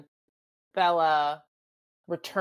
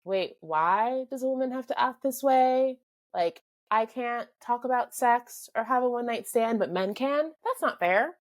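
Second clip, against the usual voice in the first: higher-pitched, mildly indignant challenge; indignant questioning; 6 semitones higher than usual. Acted. Young woman questioning unfair rules, indignant. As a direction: protest it like a puzzled newcomer.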